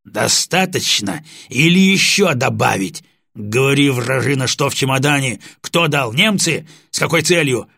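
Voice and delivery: bully the prisoner with a growled interrogation; threatening growl. Acted. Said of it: "с угрозой прорычал". (угрожающий рык сержанта на допросе)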